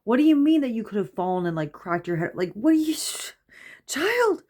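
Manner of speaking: high, fast, rising to a squeak; acted-out maternal freak-out; freaked-out mom. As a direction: scold in alarmed disbelief, pitch climbing. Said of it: "it freaked me out".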